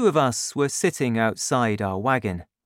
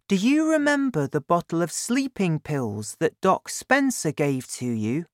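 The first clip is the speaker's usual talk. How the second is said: put-on higher boy's voice, curious; eager boy asking his dad; young Danny; 6 semitones higher than usual. Danny asks, voiced high.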